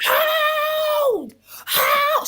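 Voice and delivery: high, loud mock scream of hold. Drunk woman screaming hold in the kitchen, loud and high. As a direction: scream hold at the top of her lungs. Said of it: "She starts screaming".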